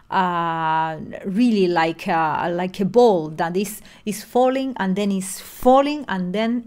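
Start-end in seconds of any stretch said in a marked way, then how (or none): none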